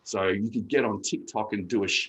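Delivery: normal talking voice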